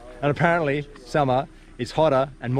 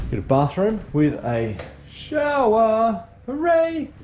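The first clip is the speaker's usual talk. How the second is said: delighted cheer; 10 semitones higher, slower than usual. Real, not acted. Delighted at the room's shower.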